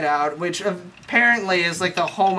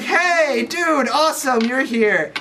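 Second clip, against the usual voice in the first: mock surfer-dude Lando; 6 semitones higher, slower than usual. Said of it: "And he's like".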